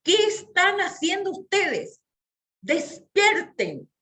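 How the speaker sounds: a hammed-up lion shouting to wake them; lion's voice. Shown as a demonstration. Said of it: "y les dijo".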